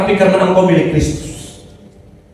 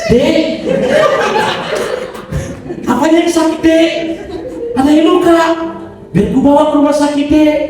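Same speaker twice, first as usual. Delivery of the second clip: put-on sweet-talking a girl; 7 semitones higher than usual. Acting sweet to a young woman.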